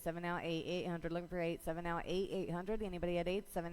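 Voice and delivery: usual speaking voice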